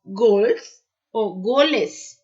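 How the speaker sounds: normal talking voice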